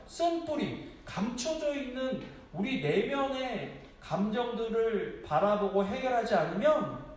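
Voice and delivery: high, loud pulpit delivery; fervent sermon voice; preaching at full force. Acted. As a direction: drive the point home to the congregation.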